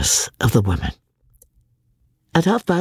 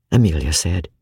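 ordinary delivery, nothing marked

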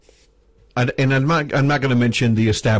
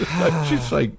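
ordinary delivery

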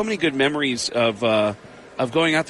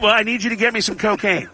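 a hint of Kinison, pitched higher; Sam Kinison impression; 6 semitones higher than usual. Prank caller as Kinison asking for cocaine, lightly voiced. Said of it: "I said".

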